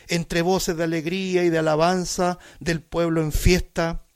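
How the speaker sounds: loud, pleading psalm recitation; preacher crying out the psalmist's lament; impassioned preaching. Acted. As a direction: cry the verses out to God. Declaims psalm as a cry.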